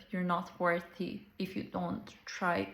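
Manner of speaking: usual speaking voice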